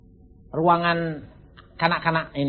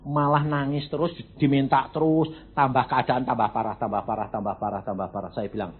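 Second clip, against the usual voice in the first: ordinary talk, nothing marked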